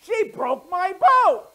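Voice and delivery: put-on high, loud, slow taunting kid; gloating boy. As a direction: gloat like a naughty brother hiding a secret. Steve gloats from bed.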